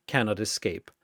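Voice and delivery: ordinary delivery, nothing marked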